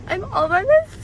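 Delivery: high, loud gleeful announcement; excited cry. Real, not acted. Girl tells viewers she's out alone, excited cry. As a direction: shout it out with glee. Thrilled at first solo outing.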